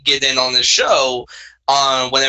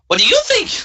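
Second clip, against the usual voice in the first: very high cartoon alien voice; squeaky Gungan impression; Jar Jar Binks voice; much faster than usual. Shown as a demonstration. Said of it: "I can make a pretty good Jar Jar Binks".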